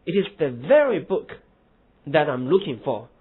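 slow, very loud; stress on very. Shown as a demonstration. Teacher stressing very in example. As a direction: punch very. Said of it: "就是very这样的一个词来强调".

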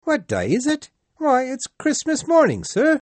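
put-on a surprised street boy answering up; young boy. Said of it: "said the boy".

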